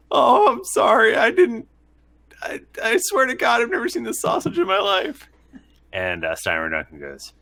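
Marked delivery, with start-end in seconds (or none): 0.1-5.3 high-pitched pleading apology; apologetic prisoner. Acted. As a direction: play a sorry prisoner protesting innocence, voice way up high. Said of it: "he's like".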